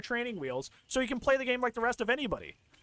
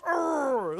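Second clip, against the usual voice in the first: imitated gremlin groan, pitched up; troll groan; 5 semitones higher than usual. Imitating the killer getting stunned, troll-like groan. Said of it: "like a troll".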